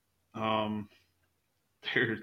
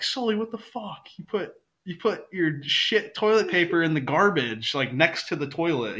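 higher, louder mock outraged scolding; imitating Ozzy's outrage; 6 semitones higher, 4 dB louder than usual. Ozzy scolding Sully about toilet paper, mock outrage. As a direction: play a roommate shocked at the bathroom. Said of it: "he's like".

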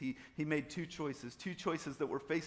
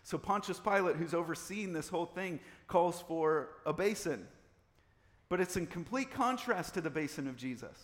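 ordinary talk, nothing marked

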